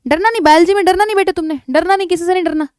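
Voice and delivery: loud pep talk. Real, not acted. Teacher telling students not to fear biology, loud rallying. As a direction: urge them on, loud and high.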